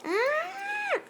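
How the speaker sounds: high scream. Pretend. Player dying in the game, high scream. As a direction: cry out high as the character falls.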